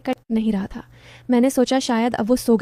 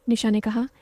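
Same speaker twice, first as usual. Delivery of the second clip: plain reading voice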